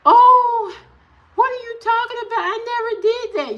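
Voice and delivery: high, fast mock mom voice; high mocking mother; mocking mama's denial. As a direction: squeak it out like an innocent mom. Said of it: "she tells me".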